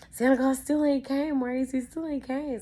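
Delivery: put-on high, loud kid's complaint; whiny child. Child complaining to brother Maurice, high and whiny. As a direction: mimic an upset little kid, pitched right up.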